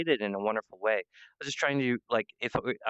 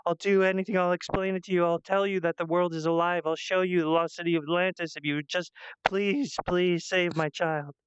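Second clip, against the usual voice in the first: God pleading on her knees; 7 semitones higher than usual. Retold or imitated. God on her knees begging to save her children, high desperate plea. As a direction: beg like a frightened mother for her children. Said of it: "God was on her knees going".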